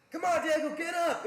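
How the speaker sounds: high, loud urging call; encouraging corner shout. Retold or imitated. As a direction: imitate a coach cheering a fighter on. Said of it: "it wasn't even like".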